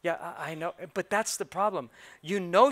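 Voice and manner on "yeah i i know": sheepish mumble. Retold or imitated. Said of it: "I always hear this".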